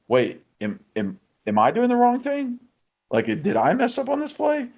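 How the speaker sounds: high, unsure self-questioning; confused second-guessing. Retold or imitated. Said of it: "where Warren goes".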